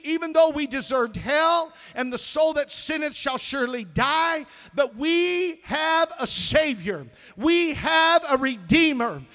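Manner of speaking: high, loud pulpit shout building in force; full-force preaching. Real, not acted. Preacher rousing a congregation to thankfulness, full-force preaching. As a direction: proclaim it at the top of your voice, stretching the key words.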